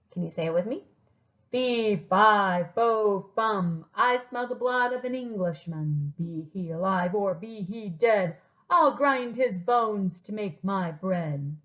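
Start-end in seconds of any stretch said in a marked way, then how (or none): 1.5-11.6 scary giant rhyme. Acted. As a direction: boom it out like a giant, drawing out the last line. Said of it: "He decided to say a scary rhyme".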